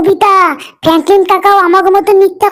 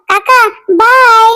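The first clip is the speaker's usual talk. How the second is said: slow, drawn-out cheeky farewell; sing-song tease as they drive off; teasing goodbye; 4 semitones higher, much slower than usual. Acted. Child waving off the uncle while driving away, teasing drawl.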